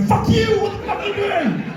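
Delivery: very high, shrill-sounding yell; mock outraged feminist. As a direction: screech it like an offended heckler. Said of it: "one feminist going".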